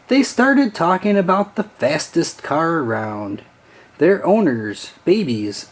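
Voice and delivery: ordinary delivery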